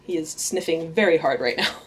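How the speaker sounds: usual speaking voice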